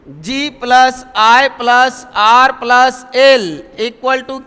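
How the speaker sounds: very slow, letter by letter; slow letter-by-letter spelling. Shown as a demonstration. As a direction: spell each letter out slowly. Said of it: "teacher spelling letters slowly".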